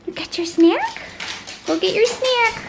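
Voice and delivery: high-pitched coaxing of a dog; pet-talk voice. Real, not acted. Owner sending her dog to get a snack, pet-talk voice. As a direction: urge the pup on in a high voice.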